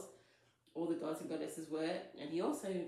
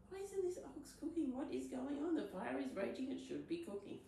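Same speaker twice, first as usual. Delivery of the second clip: high-pitched baffled voice, dropping by the end; bewildered gods, pitched well up; 9 semitones higher than usual. Acted. Gods going hungry over a raging fire, baffled.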